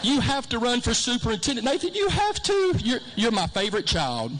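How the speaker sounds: mother on the phone telling her son he's the favorite; gushing mother. Retold or imitated. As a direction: imitate mom suddenly doting on her son. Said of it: "she goes".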